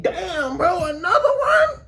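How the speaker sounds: high, whiny, half-laughing cry of dismay; hammed-up sob through a laugh; mock wail. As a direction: wail it high like a kid who can't take another hit. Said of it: "I'm like".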